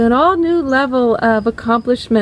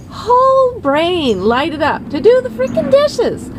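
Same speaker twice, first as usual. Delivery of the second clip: mock amazement; 6 semitones higher than usual. Mock amazement at brain study.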